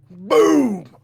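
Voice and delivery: loud, sudden "boom" for a blow; imitated boom. Waking up to a blow, imitated boom. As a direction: punch out a big impact noise.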